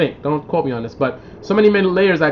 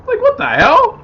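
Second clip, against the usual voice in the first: baffled; 3 dB louder than usual. Real, not acted. Baffled at being unmatched.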